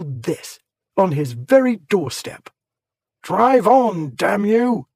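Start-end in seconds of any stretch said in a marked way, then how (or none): 3.2-4.9 louder, higher furious shout; angry shout. A narrator's reading. Warbury yelling at his coachman, furious shout. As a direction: bark it at the coachman. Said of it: "he shouted".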